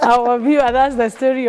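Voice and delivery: laughing. Real, not acted. Host turns to the viewer after joking with guest, laughing. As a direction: still laughing as you turn to the audience.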